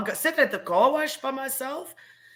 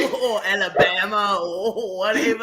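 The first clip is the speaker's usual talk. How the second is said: attempted American accent. Shown as a demonstration. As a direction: have a go at an American, maybe Alabama, accent. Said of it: "you're gonna do my accent".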